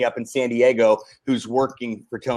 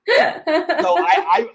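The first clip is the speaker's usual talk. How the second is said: laughing; 4 dB louder, much slower than usual. Real, not acted. Laughing after a joke.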